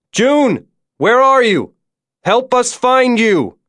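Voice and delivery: high-pitched calling, stretched at the end; shouted call. A narrator's reading. Lawrence shouts into the cave for missing June, high urgent call. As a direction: call out across a long distance. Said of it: "Lawrence shouted".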